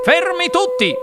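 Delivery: high, sudden command; urgent exclamation. A narrator's reading. Wonka spots movement on the screen, urgent exclamation. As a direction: call out to freeze everyone, high-pitched. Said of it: "esclamò il signor Wonka".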